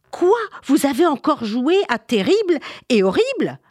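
high, indignant questioning; shocked mother. Acted. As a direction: scold the children in disbelief. Mother scolds children, shocked.

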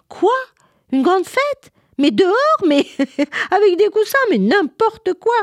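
put-on high, loud incredulous children; mocking disbelief. Village children laughing at the girl's party plan, high mocking disbelief. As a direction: squeal in mock disbelief at the idea. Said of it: "riaient bien fort".

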